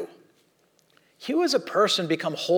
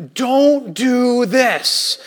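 solemn warning; 4 semitones higher, 4 dB louder, much slower than usual. Retold or imitated. Kid demonstrating gesture to class.

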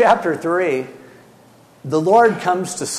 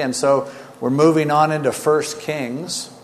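ordinary delivery

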